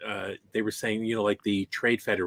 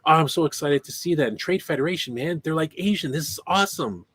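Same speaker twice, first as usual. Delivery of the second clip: a hammed-up gushing fan, higher; imitating excited fan; 6 semitones higher than usual. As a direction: play the giddy fan, pitched up. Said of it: "he was like".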